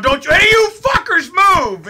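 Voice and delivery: loud, high command shout; cop yelling. Retold or imitated. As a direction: bark the order at gunpoint. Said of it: "Fucking yelling at us".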